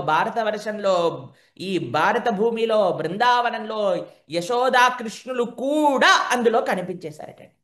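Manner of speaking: slow, rising, awestruck telling; slow wondrous recital of the universe; awed narration. A narrator's reading. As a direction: unfold the vision slowly.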